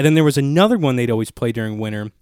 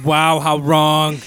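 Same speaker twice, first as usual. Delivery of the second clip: slow, drawn-out mock dismay; mock scorn; 3 semitones higher, 3 dB louder, much slower than usual. Mocking listeners who are wrong, slow and drawn out. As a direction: stretch out the disbelief. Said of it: "I said".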